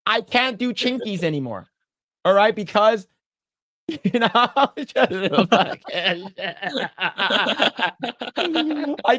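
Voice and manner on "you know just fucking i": laughing. Real, not acted. Laughing while ranting about lawsuit.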